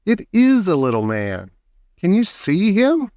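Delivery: stressed, rising amazement; Charlie crying out. A narrator's reading. Child showing Grandpa the little man, mild excited cry. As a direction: build to a high, eager question. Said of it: "cried Charlie".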